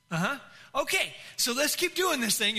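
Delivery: lightly imitated unmoved pastor; Ricky Ryan. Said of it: "he's just like".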